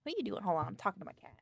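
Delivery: ordinary delivery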